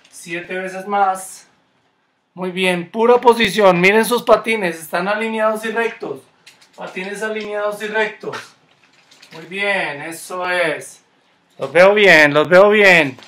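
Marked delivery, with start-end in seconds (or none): 2.9-4.8 loud coaching call. Real, not acted. Coach calling out to kids. 11.6-13.2 loud cheering. Real, not acted. Coach cheering kids on.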